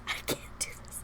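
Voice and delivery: hushed, helpless protest; overwhelmed whisper. Real, not acted. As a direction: voice gone to a whisper. Same words, voice drops away.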